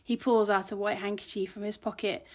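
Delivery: ordinary delivery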